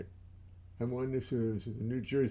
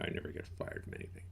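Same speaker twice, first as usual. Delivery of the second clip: quick whisper, much softer; whispered denial; 10 dB quieter, much faster than usual. Pretend. Whispering the denial to himself. Continuing the low-voiced denial.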